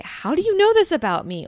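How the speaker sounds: high, loud astonished question; amazed client. Retold or imitated. Client amazed by a stranger's reading, high and loud astonished. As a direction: gasp it out like a stunned client.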